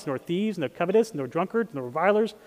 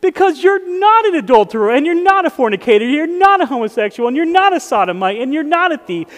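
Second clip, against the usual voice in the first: higher and louder, hitting every not; preaching at full force; 6 semitones higher, 5 dB louder than usual. Acted. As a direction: proclaim it like a sermon climax. Preaching declaration.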